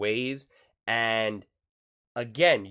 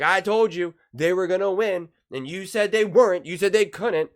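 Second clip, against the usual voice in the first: high, loud I-told-you-so; hammed-up boast in a raised voice; mock gloating; 7 semitones higher, 6 dB louder than usual. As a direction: crow at the doubters, louder and pitched up. Acts out gloating over doubters.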